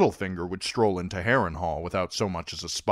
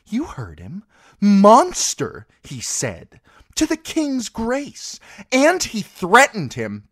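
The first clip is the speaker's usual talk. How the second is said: higher and louder, slow outraged quoting; a mother demanding punishment; indignant queen; 11 dB louder than usual. Acted. Cersei quotes Tyrion indignantly.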